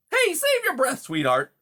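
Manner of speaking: high, loud beaver cutting in; brash interruption. A narrator's reading. Beaver cuts off the mouse's explanation, brash and loud. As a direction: barge in over the other speaker, loud. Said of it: "Mister Conrad interrupted".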